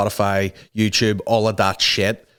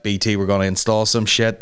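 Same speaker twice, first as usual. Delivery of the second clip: ordinary delivery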